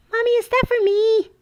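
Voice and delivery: put-on high, loud little-girl voice; Faith's voice. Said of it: "When I make that Faith voice".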